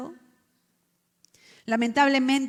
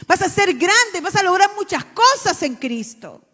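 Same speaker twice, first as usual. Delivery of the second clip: loud, high preaching; fiery preaching; 9 semitones higher, 11 dB louder than usual. Acted. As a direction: preach it with force. Preaching at full force. (predicación fuerte y apasionada)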